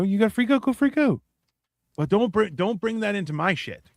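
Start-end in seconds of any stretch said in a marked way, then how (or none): none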